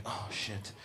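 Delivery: hushed low whispered dread; panicked whisper. Retold or imitated. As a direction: hide the panic under your breath. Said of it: "I'd be like".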